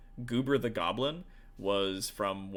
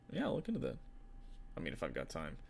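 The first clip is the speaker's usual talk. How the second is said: mock client brush-off; 6 semitones lower, 8 dB quieter, faster than usual. Mock dismissive client reply.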